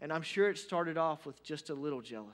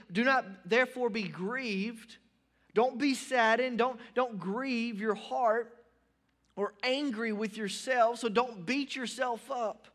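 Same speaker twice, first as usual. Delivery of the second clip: preaching at full force; 7 semitones higher, 5 dB louder, slower than usual. Real, not acted. Preacher urges listeners.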